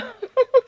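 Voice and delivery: a sudden high burst of laughter. Real, not acted. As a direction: break into a squeaky laugh. Laughing at husband's collapse.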